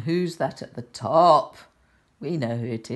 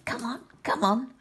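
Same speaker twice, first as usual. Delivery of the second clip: higher, softer coaxing call; little bear urging; 9 semitones higher, 5 dB quieter than usual. Acted. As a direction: coax them along, hushed. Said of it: "another one is saying".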